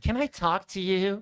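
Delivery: mimicking her. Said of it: "she wants to talk to Anna's husband".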